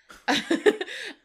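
giggling laugh up high; sudden giggle; high burst of laughter. Real, not acted. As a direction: crack up laughing. Laughing at the joke.

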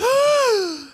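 sharp, loud mock gasp; exaggerated high gasp of horror; mock horrified gasp. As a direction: gasp like shocked kids. Kids' reaction acted out.

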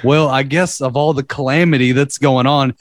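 usual speaking voice

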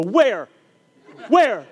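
loud, high startled shout; hammed-up excited shouting; mock alarm. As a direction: jump and cry out looking around. Teacher startled, shouts in class.

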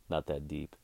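normal talking voice

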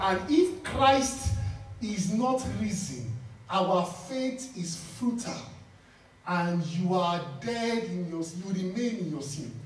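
slow, solemn reading of "And if Christ is not risen"; slow scripture reading. Acted. Pastor quoting Paul to the church, slow and solemn. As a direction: let each phrase of the verse land slowly. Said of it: "Paul said that".

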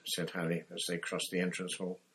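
ordinary talk, nothing marked

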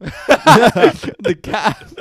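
high, loud laughing call; high laughing call of a kick. Real, not acted. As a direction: blurt it out through laughter. Acting out fight moves.

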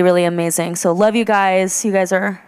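normal talking voice

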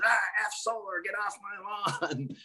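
a cranky get-off-my-lawn brush-off; grumpy homeowner. Retold or imitated. Feared homeowner shooing the salesman off, mock grumble.

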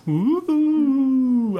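high drawn-out ghost ooh; spooky ghost noise, held long; ghostly wail. Acted. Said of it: "I'll do the ghost noise".

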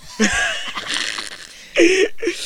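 high, loud, breath-heavy laugh; wheezy cackle. Real, not acted. Podcaster laughing after own boast, high wheezy cackle.

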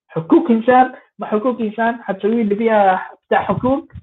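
حقوق said ħukuk, with k in place of q; mocking حقوق إنسان with both q sounds as hard k; qaf said as kaf. Mocking the crown prince, with q said as k. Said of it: "أنا بستخدم حرف الكاف".

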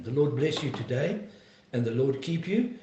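ordinary talk

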